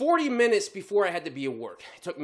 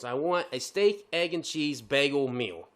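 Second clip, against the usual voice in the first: slow, careful order; slow and deliberate; 4 semitones lower, slower than usual. Retold or imitated. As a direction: spell it out slowly. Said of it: "I make sure I say what I say".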